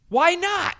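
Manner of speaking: loud high protest; mock outraged. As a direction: demand it like an indignant kid. Retold argument with Jeremiah.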